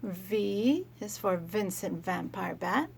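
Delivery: usual speaking voice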